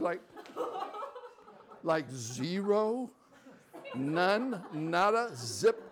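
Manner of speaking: loud, high disbelieving laugh-questions; can't believe what he's hearing; incredulous. Retold or imitated. Answering the pastor's verdict, loud disbelief.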